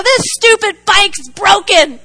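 retold exasperated complaint, high and drawn out; mock-frustrated whine, slow and emphatic; frustrated outburst. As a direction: gripe loudly at the bike, hammed up. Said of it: "Doesn't that sound like me?".

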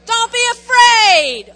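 loud, slow commanding shout; firm command. Shown as a demonstration. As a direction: order it like you mean it. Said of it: "Say it like you mean it".

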